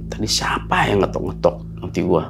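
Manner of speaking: soft, hushed question to yourself; frightened whisper. Retold or imitated. Back in her room, whispering who knocked. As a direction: whisper it, afraid of the answer.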